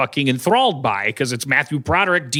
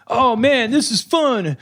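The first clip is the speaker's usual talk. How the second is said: mock partygoers; 9 semitones higher, slower than usual. Mocking the film's opening partiers in high voices. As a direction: play carefree found-footage kids. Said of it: "people going like".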